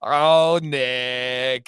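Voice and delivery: put-on drawn-out, sing-song kid's sigh; mock childish gushing; imitating swooning child. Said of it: "And she'll go".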